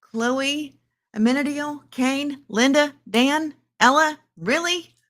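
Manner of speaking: high-pitched disbelieving roll call; mounting disbelief, pitched high; incredulous. Real, not acted. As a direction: fire off each name high, as its own astonished question. Incredulously lists names, one by one.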